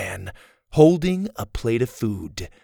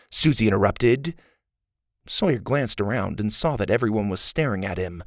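plain reading voice

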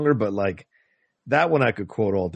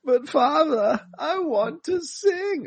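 very high, slow pleading voice; whiny prince; much slower than usual. Retold or imitated. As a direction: beg your father in a high, drawn-out whine. Prince's line from Monty Python.

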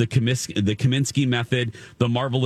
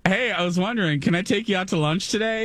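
higher bright office friend voice; chirpy coworker; 8 semitones higher than usual. Pretend. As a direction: sweetly invite her to lunch. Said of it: "let's do some role playing".

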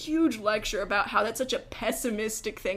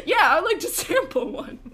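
higher, lightly mocked dad voice; imitating dad; 7 semitones higher, 4 dB louder than usual. Dad accepting a sample from the waitress, higher-pitched imitation. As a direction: put on an eager dad, pitched up. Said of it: "he's like".

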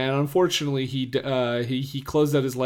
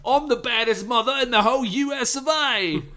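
put-on Australian accent; 9 semitones higher than usual. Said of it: "now he's Australian 'cause my accent changed".

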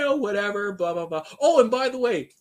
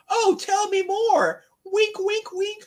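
coy fake interest; slower than usual. Vin playing Kel to get information.

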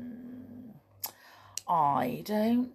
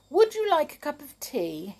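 polite offer; 8 semitones higher, 4 dB louder than usual. Acted. Mister Happy offers tea.

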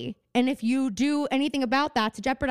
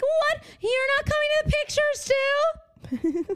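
much higher, whining mock child; whiny Annie. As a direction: whine at Daddy Warbucks like a spoiled kid. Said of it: "You'll be like".

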